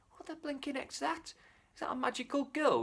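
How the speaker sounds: high, hushed amazed exclamation; the orchard keeper spotting the handless girl, high and hushed; astonished keeper. Acted. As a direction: gasp it out in disbelief.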